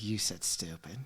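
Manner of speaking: low, near-whispered tattling; name the forbidden word under your breath; hushed child accusation. Retold or imitated. Daughter's friend revealing the bad word, in a hush.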